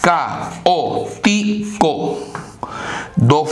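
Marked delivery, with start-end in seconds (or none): 0.0-2.2 "caótico" stretched very slowly, each vowel apart; slow demo word. Shown as a demonstration. Teacher slowly sounding out an example word. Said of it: "por ejemplo".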